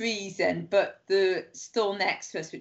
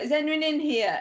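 guard calling out; 8 semitones higher than usual. Retold or imitated. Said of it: "a security guard's like".

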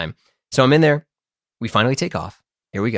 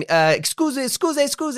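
high-pitched mimicked Italian pestering; mock-urgent, high Italian interruption; urgent Italian attendant. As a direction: imitate her insistently calling through a door. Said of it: "She's speaking Italian".

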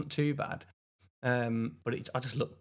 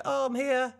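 chirpy high; 12 semitones higher, 4 dB louder than usual. Retold or imitated. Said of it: "I was like".

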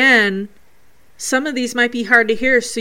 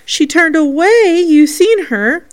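higher, louder mimicked boy; imitating Jacob; 7 semitones higher, 6 dB louder than usual. Replaying spirit conversation for viewers, raised imitating voice. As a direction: act out the boy's line, pitched up. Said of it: "this conversation between spirits".

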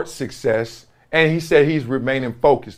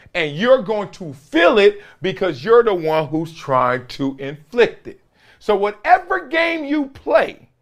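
rising, ringing preacher cadence, much higher on the key lines; preaching; 5 semitones higher than usual. Acted. Grandson of a churchgoer preaching scripture to viewers, rising sermon. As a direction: build it like a sermon from the pulpit.

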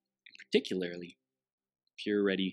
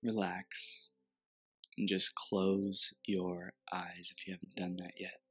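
guided meditation. Acted.

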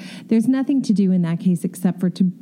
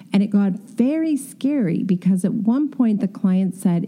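ordinary delivery

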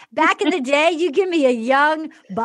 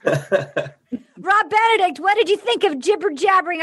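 ordinary delivery

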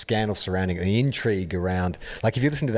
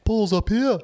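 very high mock Liverpool accent; 12 semitones higher, 4 dB louder than usual. Shown as a demonstration. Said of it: "in a terrible Beatles accent".

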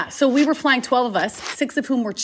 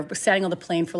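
ordinary delivery

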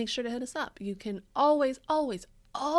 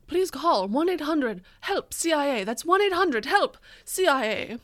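mock ad read; 4 semitones higher, 5 dB louder than usual. Mock hotline ad.

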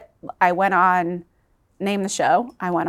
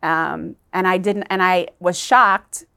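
usual speaking voice

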